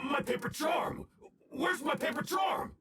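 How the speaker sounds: high, frantic cry for the charm; panicked shout. Acted. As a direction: search in sudden panic. Villain discovers charm missing.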